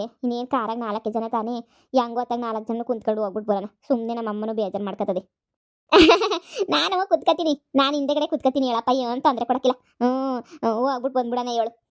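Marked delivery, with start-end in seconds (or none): 5.9-6.6 loud, high embarrassed laugh; sheepish laugh. Acted. Mother-in-law laughs loudly as she invites herself on the trip.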